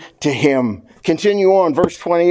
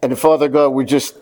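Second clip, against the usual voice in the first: prayer; 2 semitones lower than usual. Acted. Pastor praying for the church, hushed prayer.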